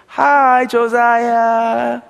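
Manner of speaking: put-on high, slow baby talk to a little son; father cooing to his baby son Josiah; cutesy talk. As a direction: coo sweetly like a proud new dad. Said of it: "he's just doing this cutesy talk".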